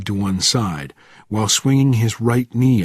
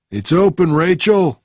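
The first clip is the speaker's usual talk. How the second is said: raised, high call from indoors; loud, higher-pitched holler; shouting; 8 semitones higher, 5 dB louder than usual. A narrator's reading. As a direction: shout it out to someone at the door. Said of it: "shouted the voice from within".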